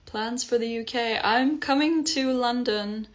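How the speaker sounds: normal talking voice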